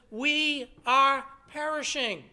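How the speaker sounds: slow, weighty retold cry of alarm; frightened plea. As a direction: quote their fear slowly and emphatically. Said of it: "the emotions are high. They are frightened".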